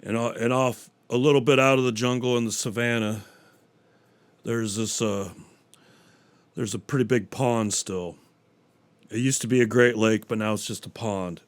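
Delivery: low, quiet nature-documentary telling; hushed storyteller. Acted. Comic telling a joke to the audience in a low hush.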